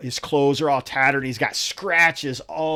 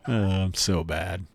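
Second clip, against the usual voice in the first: ordinary talk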